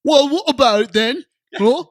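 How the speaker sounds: loud, high Cockney geezer voice; Cockney accent. Acted. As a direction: bellow it like a London street tough. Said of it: "Do your Cockney accent".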